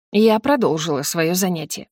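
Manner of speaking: plain reading voice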